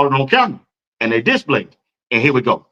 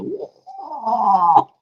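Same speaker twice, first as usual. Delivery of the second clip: long, higher-pitched "whoa", softly; drawn-out whoa; 6 semitones higher, 4 dB quieter than usual. Retold or imitated. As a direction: let out a long falling whoa. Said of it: "I said".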